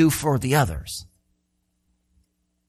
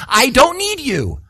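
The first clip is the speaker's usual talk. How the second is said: higher, slow and dismissive; arrogant; 7 semitones higher than usual. Pretend. Eye snubbing the hand, arrogant and slow. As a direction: sneer it down at the hand. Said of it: "the eye has the arrogance to say".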